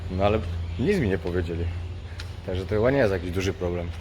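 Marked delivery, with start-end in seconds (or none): none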